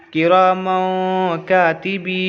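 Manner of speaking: "किरामों कातिबी" slowly, "मों" held in the nose; ikhfa nasal hold. Shown as a demonstration. Teacher builds the word, ikhfa nasal hold. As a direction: hum through "मों", then chant "कातिबी". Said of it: "नाक में आवाज़ को रोकेंगे".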